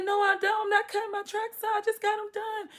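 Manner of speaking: very high, loud refusal; indignant protest. Retold or imitated. Inmate refusing to cut her tracks, loudly indignant. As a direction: protest at the top of your voice.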